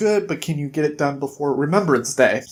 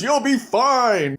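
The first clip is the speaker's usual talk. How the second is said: high, drawn-out mock brush-off; retold dismissal; 7 semitones higher, slower than usual. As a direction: wave away the complaint, stretching the words. Bigsby brushes off injured Jack.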